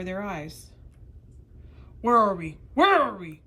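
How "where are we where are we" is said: loud, alarmed cry of a startled harpooner. Acted. Canadian harpooner bursts into the strange room, crying out loud. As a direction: call it out loud, twice, bewildered. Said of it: "cried the Canadian".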